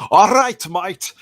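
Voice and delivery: shouted high Aussie-Scottish hybrid; Scottish-Australian hybrid accent. Shown as a demonstration. Demonstrating his failed Aussie, loud Scottish-Australian hybrid. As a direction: yell it in muddled Aussie-Scots. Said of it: "a hybrid of Scottish and".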